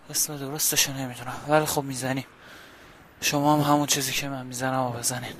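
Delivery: ordinary talk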